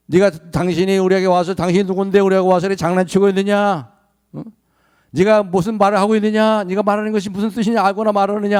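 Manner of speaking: high, loud hostile demand; the crowd confronting Moses, indignant; mock angry challenge. Israelites voiced challenging Moses. (모세에게 대드는 백성의 화난 목소리)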